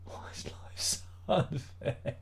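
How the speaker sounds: whispered, slow, half-laughing lament; mock despair whisper. Real, not acted. Streamer mock-despairing at the label printer, slow whisper.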